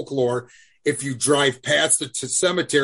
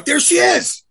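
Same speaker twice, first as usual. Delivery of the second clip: high and loud, delighted; excited; 6 dB louder than usual. Real, not acted. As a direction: announce it with a grin. Pointing out the ghost picture.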